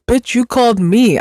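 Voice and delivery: high-pitched, exasperated comeback; mock outrage at the caller; indignant retort. Annoyed callee talking back to the robocall, indignant.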